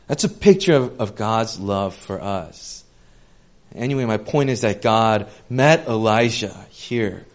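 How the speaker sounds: usual speaking voice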